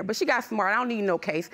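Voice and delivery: ordinary talk, nothing marked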